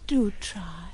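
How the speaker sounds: breathless whispered plea. Acted. Woman pleading with her lover to love her, whispered. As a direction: whisper it, begging him.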